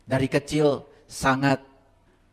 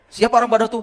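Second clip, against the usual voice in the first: raised, louder teasing question; loud challenge; 9 semitones higher, 7 dB louder than usual. Real, not acted. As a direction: fling the question at the crowd. Preacher quizzes audience loudly. (pertanyaan menantang yang lantang)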